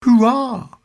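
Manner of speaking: short, loud, high cheer; shouted cheer. A narrator's reading. Pirates cheer their new ship, short loud shout. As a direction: shout a brief hurrah. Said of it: "shouted the pirates".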